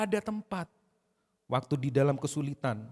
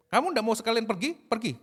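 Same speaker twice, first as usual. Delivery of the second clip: raised-pitch challenge, sending them away; challenging dismissal; 7 semitones higher, 5 dB louder than usual. Acted. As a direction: dare them to leave, high and firm. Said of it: "Itu intonasinya bisa lain loh".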